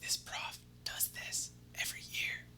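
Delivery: unvoiced whisper, soft and secretive; classmate whisper. Classmate leaning over during the quiz, secret whisper. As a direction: slip the answers over under your breath. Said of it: "leans over and goes".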